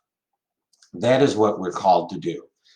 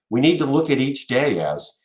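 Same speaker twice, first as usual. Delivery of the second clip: ordinary talk, nothing marked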